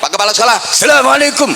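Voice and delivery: high, loud hail rising on the salam. Shown as a demonstration.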